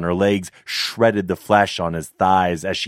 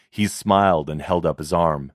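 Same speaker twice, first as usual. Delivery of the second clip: plain reading voice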